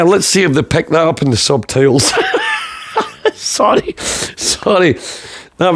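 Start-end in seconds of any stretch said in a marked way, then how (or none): 2.2-3.3 squealing laugh at own joke; a high breathy burst of laughter; high giggle. Real, not acted.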